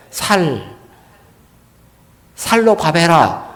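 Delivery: 살 said with a plain, soft s instead of a tense ss; Gyeongsang s for ss. Shown as a demonstration. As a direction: imitate a Daegu speaker who says 살 for 쌀, softly. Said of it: "쌀 발음이 안 돼".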